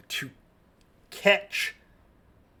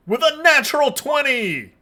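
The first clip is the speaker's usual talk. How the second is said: excited; 11 semitones higher, 10 dB louder than usual. Real, not acted. GM announces critical roll.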